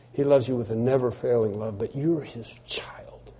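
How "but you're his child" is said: hushed whisper. Real, not acted. Preacher whispering to his congregation, tender hush. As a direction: breathe it, barely voiced.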